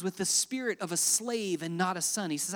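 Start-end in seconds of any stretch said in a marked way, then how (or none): none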